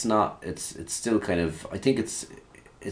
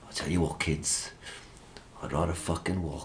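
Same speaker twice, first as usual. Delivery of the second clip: Liam impression; 2 semitones lower, 8 dB quieter than usual. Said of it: "He's like".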